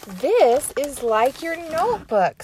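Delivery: a hint of little sister Annie. Teacher reading to kids, voicing Annie.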